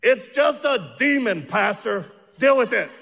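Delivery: loud, high mock-exasperated wife; wife's blunt retort. Wife scolding the scared pastor, loud and high. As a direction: bark it like an unimpressed wife. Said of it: "My wife goes".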